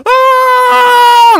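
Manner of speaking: very high, loud mock scream; freaked-out scream. Girl facing her monster mother, high imitated panic scream. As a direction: imitate a terrified girl's long scream. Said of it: "like, all fucking freaking out".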